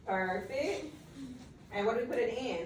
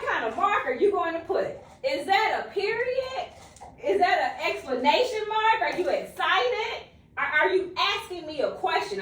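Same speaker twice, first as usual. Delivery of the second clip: playful quizzing; 6 semitones higher, 4 dB louder than usual. Acted. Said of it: "playful quizzing".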